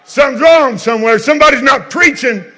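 loud, high, shouted revival preaching; full-force preaching. Acted. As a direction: preach it hard to the congregation.

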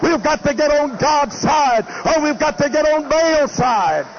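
preaching at full force. Real, not acted. Preacher warning his congregation against sin, full-force preaching. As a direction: hammer each warning at the congregation.

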